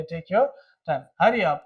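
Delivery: ordinary delivery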